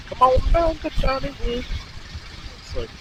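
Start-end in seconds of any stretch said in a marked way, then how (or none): 0.1-1.7 put-on high-pitched stab at an Irish accent; attempted Irish accent. Rider trying out Irish for a place name, attempted Irish accent. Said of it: "that'd be like Irish".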